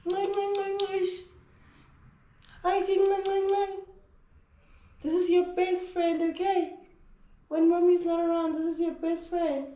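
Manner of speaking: high, cooing pet-talk voice. Real, not acted. Cooing to her dog.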